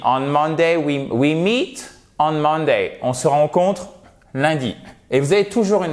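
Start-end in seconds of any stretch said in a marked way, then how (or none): 0.1-3.0 MON-day, the "Mon" of "Monday" hit hard, the rest light; "On Monday" and "we meet on Monday", punching "Mon" each time; stress on Monday's first syllable. Shown as a demonstration. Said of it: "l'accent tonique sur la première syllabe". (accent tonique sur « Mon » de « Monday »)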